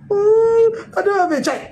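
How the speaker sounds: high-pitched fake cry of childlessness; mock wailing. Preacher mocking a childless woman's lament, fake crying. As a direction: wail it like a hammed-up lament. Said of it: "It was fake".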